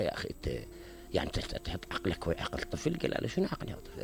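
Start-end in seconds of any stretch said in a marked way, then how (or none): 0.0-3.0 low, whispered rebuke in the quarrel; hushed retort. Retold or imitated. Brother scolding brother over the boy, whispered. 3.3-4.0 breathless, unvoiced defence of the slap; whispered reply. Brother answering back about the curse, whispered. Retold quarrel between brothers.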